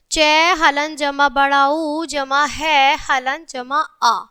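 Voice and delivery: slow, syllable by syllable; slow spelling out. Shown as a demonstration. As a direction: spell it out slowly for children. Teacher spells out letter by letter. (धीरे-धीरे अक्षर जोड़कर बोलना)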